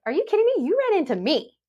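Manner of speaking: high, stunned disbelief; can't believe you hit me, voice shooting up; incredulous outrage. Retold or imitated. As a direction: disbelief at the driver who rear-ended her, high incredulous pitch. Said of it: "And I'm sitting there like".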